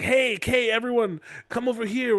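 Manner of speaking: high call to gather everyone; mock summons. Father gathering everyone for his plan, mock summons.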